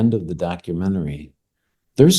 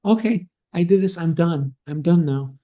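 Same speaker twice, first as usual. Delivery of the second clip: higher-pitched imagined inner voice; voicing her thoughts; 8 semitones higher than usual. Guest voices the catfisher's thinking, higher mock inner voice. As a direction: imitate someone breezily wrapping things up. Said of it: "in her mind".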